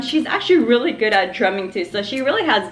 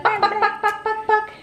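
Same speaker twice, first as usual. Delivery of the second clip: high clucking bak bak like a hen; chicken noises; 11 semitones higher than usual. Pretend. Mother clucking like a chicken to amuse her baby, high and playful. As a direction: cluck like a little chicken to make baby laugh. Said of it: "She loves it when I do that".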